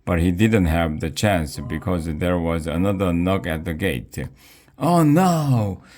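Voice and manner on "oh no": high, drawn-out cry of dismay; exasperated holler, pitched way up; dad's holler. A narrator's reading. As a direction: holler it high, letting it fall away. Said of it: "my dad hollered".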